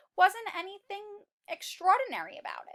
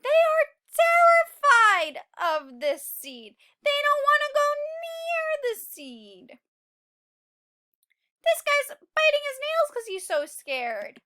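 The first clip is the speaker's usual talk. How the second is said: higher, louder storytelling energy; dramatic excitement; 12 semitones higher, 10 dB louder than usual. Acted. Teacher dramatizing the terrified seeds, higher and louder. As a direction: play up the fear for the kids.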